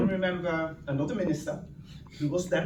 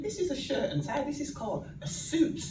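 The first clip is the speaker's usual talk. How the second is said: imitating the minister; 9 semitones higher than usual. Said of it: "He went".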